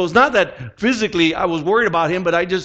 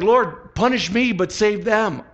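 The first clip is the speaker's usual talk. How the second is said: higher-pitched imitated pleading; acted fervent plea; 6 semitones higher, slower than usual. Voicing Paul begging God for his people, acted fervent plea. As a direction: plead earnestly on others' behalf.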